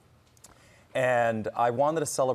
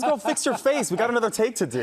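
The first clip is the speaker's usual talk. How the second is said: laughing J Lo impression; 6 dB louder than usual. [name]'s reply, retold with laughter.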